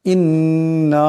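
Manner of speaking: ইন্না with the doubled nun held one alif long through the nose; nasal ghunnah. Shown as a demonstration. Teacher showing students the ghunnah in ইন্না. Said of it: "এক আলিফ পরিমাণ গুণনা করিয়া পড়তে হবে".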